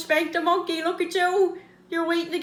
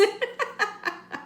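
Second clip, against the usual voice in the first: laugh; 8 semitones higher, 6 dB quieter than usual. Real, not acted. Laughing at own joke.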